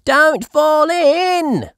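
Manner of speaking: very high, loud, slow warning; Peppa's voice. A narrator's reading. Peppa warning Grandpa not to fall in the pond. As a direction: beg him to be careful. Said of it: "says Peppa".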